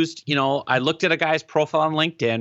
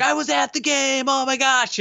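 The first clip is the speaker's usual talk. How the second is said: excited reply; 11 semitones higher than usual. Retold or imitated. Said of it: "he writes back".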